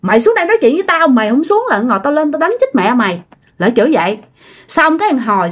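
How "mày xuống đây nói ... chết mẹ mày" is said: put-on escalating drunk threat. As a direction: push the challenge harder, spitting it out fast. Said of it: "nó nhìn lên trên cái trần nhà, nói". (giọng nhại dọa đánh, cao hơn hẳn, rất nhanh)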